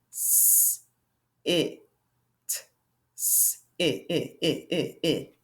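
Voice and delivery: soft, whispered s, then it, it; tapping out sit. Shown as a demonstration. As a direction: tap out s, it quietly. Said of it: "Let's hear that".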